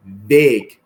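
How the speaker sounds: put-on Punjabi-accented word, punched out loud. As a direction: say it loudly in an attempted Punjabi accent. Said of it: "say it with a Punjabi accent".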